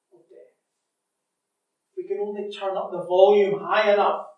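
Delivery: loud, driving pulpit delivery; full-force preaching. Acted. Preacher to congregation about avoiding death, preaching loudly. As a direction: press the point hard on the congregation.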